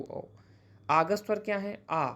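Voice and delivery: normal talking voice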